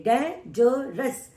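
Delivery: Dangerous slowly split as डें, ज, रस; syllable by syllable. Shown as a demonstration. Teacher showing students three sounds, dangerous broken into syllables. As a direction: give dangerous three separate beats with gaps. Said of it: "यहाँ पे तीन आवाजें आ रही है".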